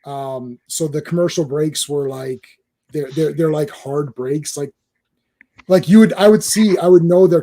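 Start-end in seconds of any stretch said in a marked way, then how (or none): none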